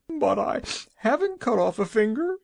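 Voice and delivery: high, tearful sob; sobbing. A narrator's reading. Weeping woman sobs to the Wizard. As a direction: sob it through tears. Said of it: "she sobbed".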